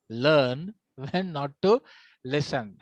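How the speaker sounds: very slow, spaced out for the class; slow emphatic repeat. Shown as a demonstration. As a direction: break it into deliberate chunks, dropping on "listen". Maxim repeated slowly for emphasis.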